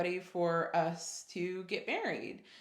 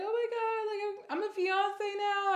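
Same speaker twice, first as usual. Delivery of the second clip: high, overcome re-enactment; squeaky emotional gushing, acted out; all emotional; 12 semitones higher than usual. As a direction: gush it, pitched way up, as a new fiancée. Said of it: "I'm getting all emotional".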